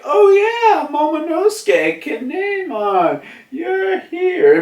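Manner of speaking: high, hammed-up welcome; excited greeting. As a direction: greet late arrivals in a squealing, drawn-out voice. Crew greets arriving friends.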